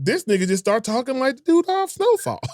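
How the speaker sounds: high-pitched imitation of baffled onlookers; mock disbelief. Host imagines people hearing his new accent, mock disbelief. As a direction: voice their astonishment, pitched well up. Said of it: "They be like".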